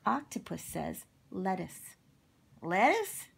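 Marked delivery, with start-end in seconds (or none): 2.6-3.3 high, rising, incredulous echo; baffled question. Acted. Cowboy puzzled by Octopus's joke answer, incredulous. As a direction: echo it upward in loud disbelief.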